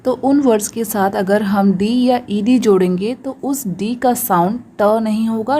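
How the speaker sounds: ordinary talk, nothing marked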